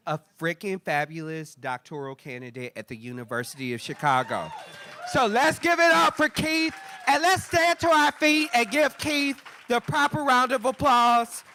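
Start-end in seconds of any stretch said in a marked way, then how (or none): 5.1-11.4 high, loud stage introduction, stretching out the final words; rousing hype shout. Real, not acted. Host calling the room to applaud Keith, rousing shout. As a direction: whip the crowd into a standing ovation.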